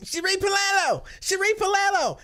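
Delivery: very high, squealing mock yell of the name; schoolgirl yell. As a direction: shriek the name like an excited little schoolgirl. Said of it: "yelling like a little schoolgirl".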